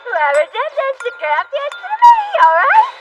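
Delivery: put-on angry drunk. Angry drunk shouting at table.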